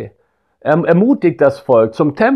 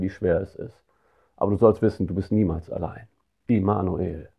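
much softer and lower, tender and intimate; hushed comfort; 5 semitones lower, 8 dB quieter than usual. Acted. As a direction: say it like a hand on the shoulder. Comforting someone in a marriage crisis.

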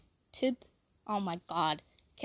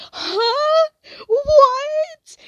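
high, laughing-crying huh; stunned disbelief breaking up; bewildered; 6 dB louder than usual. Retold or imitated. Startled in her shifting daydream by Dally, baffled and high. Baffled reaction while counting.

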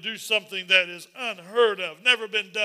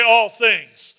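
loud call and response; 10 dB louder than usual. Shown as a demonstration. Pastor calling on the church to repeat it loudly. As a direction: say it big and clear, all together. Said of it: "Everyone say".

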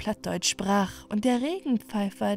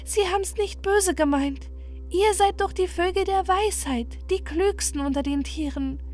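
gentle pleading princess; 6 semitones higher than usual. Acted. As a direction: coax the wise owls kindly. (höher gesprochenes, bittendes Moorprinzesschen)

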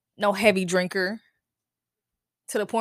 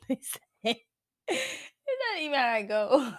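words broken by laughter; laugh-crying through the line; 3 semitones higher, 3 dB quieter, slower than usual. Real, not acted. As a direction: crack up mid-sentence.

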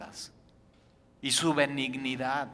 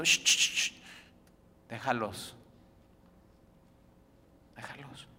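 calm hush, letting them be; 4 semitones lower than usual. Acted. Jesus hushes critics, voiced.

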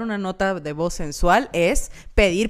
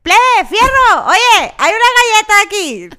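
loud rough dude voice; 10 semitones higher, 9 dB louder than usual. Retold or imitated. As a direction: blare it like a rowdy guy yelling across a room. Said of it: "la nota de voz de".

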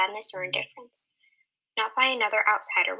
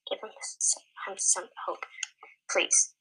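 softer whispered begging; soft plea. Acted. Arminick begging Summer to help, a soft whisper. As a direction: plead quietly, almost breathless. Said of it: "Arminick's voice softened".

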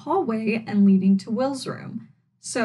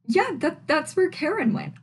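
higher, dawning surprise at the discovery; surprised realization; 7 semitones higher than usual. Real, not acted. Host realizing where Karen went, surprised. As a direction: say it again as it finally clicks.

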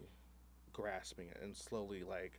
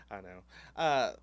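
ordinary talk, nothing marked

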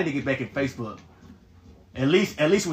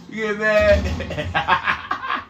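drawn out and higher, laughing; mock teasing; 4 semitones higher, much slower than usual. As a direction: tease him slowly through a laugh. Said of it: "Look, he's getting mad".

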